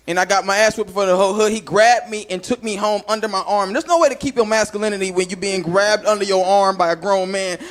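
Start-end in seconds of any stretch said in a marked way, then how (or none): none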